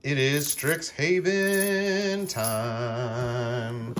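slow, drawn-out hype announcement; announcer voice. Acted. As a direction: stretch it like a show host. Hyped set announcement.

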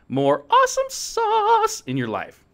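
very high, slow squeal; squeaky hype. Pretend. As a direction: squeal it with glee.

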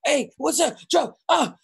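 ordinary delivery

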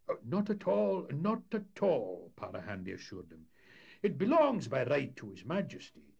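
sly skipper waving off responsibility; a canny Scots captain feigning innocence; Para Handy voice. Acted. Captain disowning the whale.

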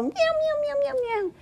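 tiny high mews, softly in a row; little kittens following. Acted. Kittens follow the mother cat, soft high mews. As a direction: mew softly and very high, one after another. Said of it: "and the little kittens follow".

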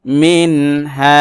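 মিন হা with a distinct n, no nasal hum; clear izhar. Shown as a demonstration. Teacher answering how izhar is done, clear nun. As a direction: sound the n of মিন clearly, then a drawn-out হা. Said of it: "গুণনা ছাড়া আদায় করতে হবে".

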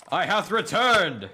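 grand announcement. Pretend.

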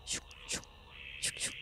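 barely audible whisper. Real, not acted. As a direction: hush it out sneaking. Sneaking past monster. (숨죽인 속삭임)

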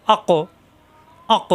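আক with the final consonant pushed into an echoing bounce; bouncing qalqala. Shown as a demonstration. Teacher showing qalqala to learners, আক with a pushed, bouncing ending. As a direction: bounce off the end of আক with a little release. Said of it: "ধাক্কা দিয়ে পড়তে হবে".

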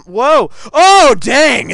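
loud, high burst of surprise; explosive astonished yell; shocked shout. Real, not acted. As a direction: shout it out, stunned by the game. Shock at sudden game kill.

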